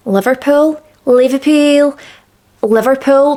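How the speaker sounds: Liverpool with a Scouse Liverpudlian vowel; Liverpool accent. Shown as a demonstration. Host gushing over her favourite accent, saying Liverpool in Scouse. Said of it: "favourite accent, Liverpudlian".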